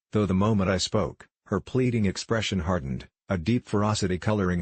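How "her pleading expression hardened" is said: plain reading voice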